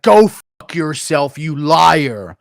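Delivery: mock scream, slow and drawn out; Luke Thomas. Streamer mocking a pundit's on-camera rant, mock screaming. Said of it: "Luke Thomas screaming at the camera".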